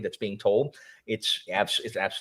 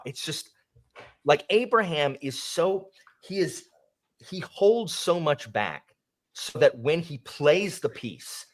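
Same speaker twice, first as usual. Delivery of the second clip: ordinary talk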